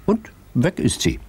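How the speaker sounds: plain reading voice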